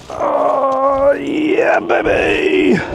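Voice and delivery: high, shouted celebration; triumphant shout. Real, not acted. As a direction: shout for joy. Second fish landed.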